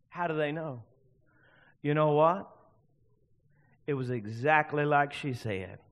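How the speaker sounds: ordinary talk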